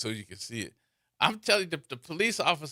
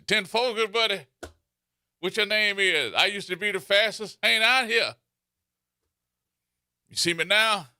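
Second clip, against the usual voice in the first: CB trucker talk; 6 semitones higher than usual. Pretend. CB trucker chatter, raised voice.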